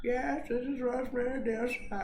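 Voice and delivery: high, put-on old lady voice; old granny voice. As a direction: play a frail old granny on the phone. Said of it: "he just talked like a, like an old gr".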